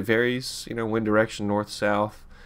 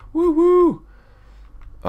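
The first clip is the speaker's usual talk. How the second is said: very high, loud train toot; playful falsetto "woo woo"; train whistle imitation; 5 dB louder than usual. Imitates train after "train of thought.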